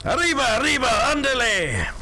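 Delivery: high-pitched, hammed-up Spanish whooping; mock Spanish cry. Shown as a demonstration. Streamer showing off some Spanish, high mock Spanish cry. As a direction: call it out very high in a cartoonish Spanish accent. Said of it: "There's some Spanish".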